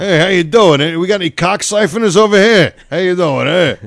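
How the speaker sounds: hammed-up New York accent hustling at the pump; mock tough-guy accent. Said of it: "do the, uh, accent".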